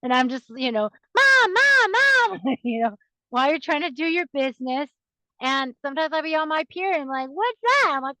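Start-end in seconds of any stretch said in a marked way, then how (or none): 1.2-2.6 put-on high, loud toddler calling "Mom"; child calling mom. 7.3-7.9 put-on high, curious little kid question; curious child. Child asking about mom's period.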